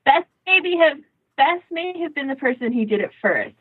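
ordinary talk

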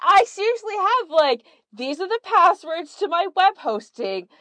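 a hint of a panicked will-maker; lightly voiced mock desperation; mock urgent instructions. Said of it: "I'm like".

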